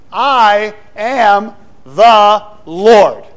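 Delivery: very slow, loud, every word separately hammered; emphatic declaration. Acted. God standing over defeated deities, emphatic declaration. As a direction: deliver it word by word with full force.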